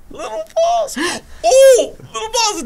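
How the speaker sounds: high, giggly repeat of the punchline; giggling impression. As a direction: giggle like a kid who just got the joke. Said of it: "He's just like".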